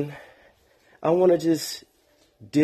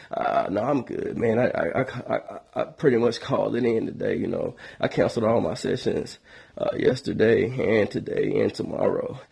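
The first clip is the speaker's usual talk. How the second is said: low, soft, feeble sick man; put-on sick voice; 4 semitones lower, 4 dB quieter than usual. Answering the friend, low and soft in a fake sick voice. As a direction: play up how ill you feel. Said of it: "I answer with my sick voice".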